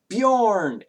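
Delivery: sisters calling out, high and loud; excited cry. Acted. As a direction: call his name out in joy. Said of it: "they cry this out".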